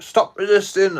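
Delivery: imitating the officer yelling the command; retold arrest shout; mock police shout. Police quoted during arrest.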